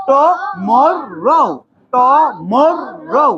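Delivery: very slow "টমরো" in three beats; each syllable of "টমরো" drawn out. Shown as a demonstration. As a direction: sound out ট-মর-রো. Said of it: "syllable করে করে পড়ো".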